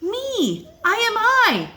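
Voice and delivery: loud and high, slowing on the last line; shouted proudly. A narrator's reading. Child shouting from the sounding-off place, loud and proud. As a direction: shout it to the sky with joy. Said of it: "Shout loud at the top of your voice".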